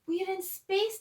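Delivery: high, loud cry of wonder; excited yell. A narrator's reading. Danny yelling as they float in space, high and excited. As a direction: shout it out in amazement. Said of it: "Danny yelled".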